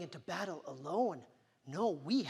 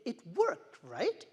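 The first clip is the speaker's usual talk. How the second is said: usual speaking voice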